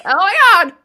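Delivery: high, hammed-up Californian accent; Californian impression. Showing off an impression, mock Californian accent. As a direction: gush it as a mock California girl. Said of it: "that's like my Californian impression".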